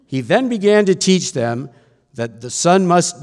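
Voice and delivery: loud, emphatic Bible reading; scripture reading. A narrator's reading. Preacher reads Mark's account to the congregation, loud and emphatic. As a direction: read it out firmly to a congregation.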